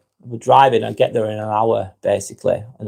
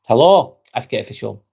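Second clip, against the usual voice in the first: usual speaking voice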